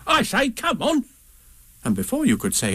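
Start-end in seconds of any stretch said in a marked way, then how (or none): 0.1-1.0 much higher, bouncy Tigger voice; Tigger calling. A narrator's reading. Tigger popping out of the mist, high excited call.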